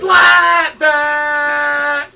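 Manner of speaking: drawn-out hype yell; loud shout. Acted. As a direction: bellow it to pump up the room. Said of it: "Come on, get into it".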